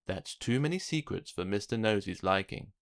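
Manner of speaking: ordinary delivery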